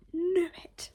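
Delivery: excited triumph, pitch jumping. Real, not acted. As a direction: blurt out "knew it" in triumph. Triumphant outburst.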